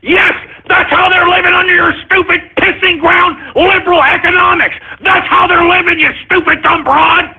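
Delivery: high, yelling rant, close to cracking; furious shout. Real, not acted. Host yells at Hillary about how people live, furious shout. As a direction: scream it at her, losing control.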